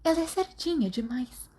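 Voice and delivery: a hint of a worried schoolgirl. A narrator's reading. Mary voiced in read story.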